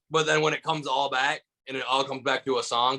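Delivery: normal talking voice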